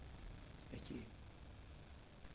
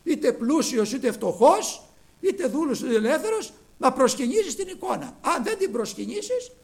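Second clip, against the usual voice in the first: Antichrist's demand; 8 semitones higher, 11 dB louder than usual. Retold or imitated. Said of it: "Σου λέει".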